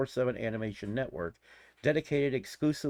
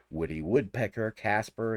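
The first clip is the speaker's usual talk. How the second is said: usual speaking voice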